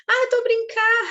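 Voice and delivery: high, loud, breezy brush-off; mock excuse. Parent claiming it was only a joke, high and breezy. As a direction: laugh it off as a joke. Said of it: "Ainda fala brincar".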